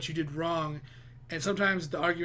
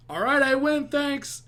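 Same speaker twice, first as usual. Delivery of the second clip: mock triumph; 9 semitones higher, 6 dB louder, slower than usual. Mock victory line to wife.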